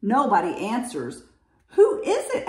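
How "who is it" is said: high, raised question; high-pitched story-bear query; bear asking. A narrator's reading. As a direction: ask it high and eager. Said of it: "asks Bear".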